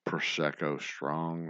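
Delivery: slow, drawn-out tipsy words. Real, not acted. Tipsy host to viewers, deliberately slow speech. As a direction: stretch each word out slowly. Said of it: "talk slower".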